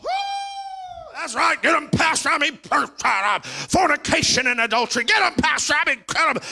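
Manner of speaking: whooping mock cheer, high and loud; cheering churchgoer. Mock congregant cheering the pastor on, whooping and loud. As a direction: ham up an amen-corner cheerleader.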